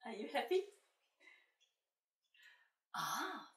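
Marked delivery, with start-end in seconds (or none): none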